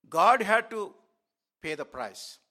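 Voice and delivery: a sudden preached outburst; emphatic preaching. Real, not acted. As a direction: proclaim it out loud to the church. Preaching climax.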